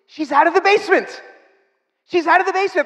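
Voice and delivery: high, thrilled retelling of good news; excited news. Retold or imitated. Telling Susie on the phone, high and thrilled. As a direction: announce it like you can't believe it.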